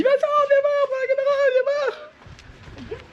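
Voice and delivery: very high-pitched mock yell; imitated cry. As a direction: imitate someone excitedly calling you over in a high squeal. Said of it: "Débo, elle a crié, elle m'a dit".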